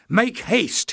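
high urgent shout. A narrator's reading. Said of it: "he shouted".

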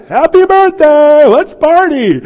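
high, very loud party cheer; hammed-up celebratory holler; surprise-party shout. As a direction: yell like a crowd jumping out. Surprise party crowd greets him.

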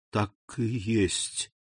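soft, slow murmur. A narrator's reading. Abbé confirms his suspicion to himself, in a slow murmur. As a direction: drop your voice and draw it out. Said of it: "прошептал аббат".

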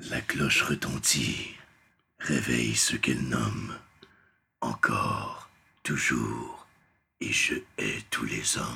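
deep, slow demonic voice; voix démoniaque. Shown as a demonstration. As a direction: read it like a demon rising. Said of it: "faudrait que je le fasse avec ma voix démoniaque". (voix de démon grave et lente)

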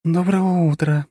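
slow, purring good morning. Acted. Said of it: "промурлыкала она".

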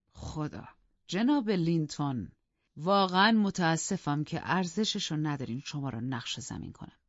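scornful chuckle into slow mock regret. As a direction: taunt the rival with fake apology. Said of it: "با تمسخر".

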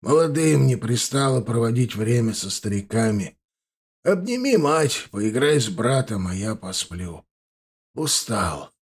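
put-on tired old grandfather, fading; a weary old man drifting to sleep. A narrator's reading. Grandfather sends boy away, tired. (усталый голос очень старого человека)

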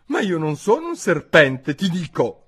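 high, loud protest; indignant high-pitched denial; exclaiming. A narrator's reading. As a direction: insist in a girl's high voice. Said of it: "esclamò Alice".

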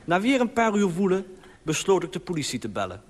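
usual speaking voice

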